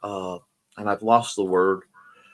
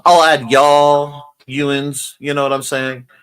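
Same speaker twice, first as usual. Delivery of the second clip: Southern accent; 2 semitones higher, 7 dB louder, slower than usual. Shown as a demonstration. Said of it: "Southern people".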